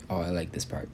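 quietly to the listener; murmured reader's comment; soft aside. Real, not acted. Reader's aside, much softer.

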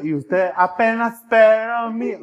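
high, slow mock mother insisting; clingy mother. Mother insisting the son is hers, high and slow. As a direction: drag out the claim like a stubborn mom.

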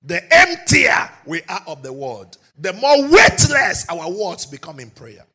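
loud, ringing sermon delivery; rising pulpit shout; full-force preaching. Acted. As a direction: drive it home to the congregation. Preaching climax.

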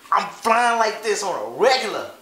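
loud, high bragging; boastful. Real, not acted. Bragging to friend about Miami days, loud and high. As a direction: show off the good life.

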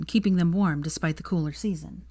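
usual speaking voice

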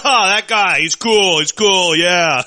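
loud, high mock cheering; rowdy crowd reaction. Friend imitating guys reacting to an impression, loud mock approval. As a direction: whoop it up like the whole locker room. Acting out everyone's reaction in locker room.

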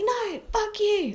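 high, drawn-out mock yell; retold angry yell. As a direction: act out the anger with pitch shooting right up. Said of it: "I was just so angry".

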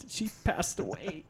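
mock tearful stammer, breaking into a laugh; a badly faked sob that dissolves into giggles; fake tearful. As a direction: pretend to choke up but crack up laughing. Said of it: "pretending like I was tearing up".

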